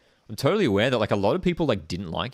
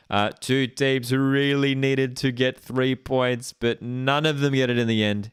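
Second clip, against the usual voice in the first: higher, slower broadcast wrap-up; full-time commentary; 5 semitones higher, slower than usual. Acted. Mock commentator calling full time, higher and slow. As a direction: sign off the match like a TV commentator.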